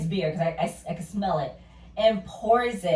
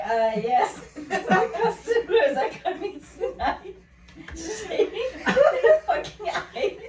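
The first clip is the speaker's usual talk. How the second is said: high, slow laughing mock bartender; mock bar staff, laughing; 10 semitones higher, 3 dB louder, slower than usual. Friends joking about cheap bar staff, high laughing mock voice. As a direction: play the bartender shaving ice, laughing. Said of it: "they're like".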